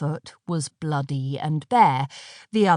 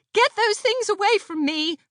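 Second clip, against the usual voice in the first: high, disgusted yelp; frightened shriek; 14 semitones higher than usual. A narrator's reading. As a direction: push the snails away with your voice. Aneshka recoiling from snails.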